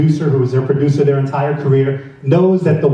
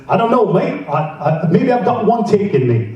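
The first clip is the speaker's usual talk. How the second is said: higher-pitched mock English accent; bad English accent; 5 semitones higher than usual. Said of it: "I wish I had an English accent".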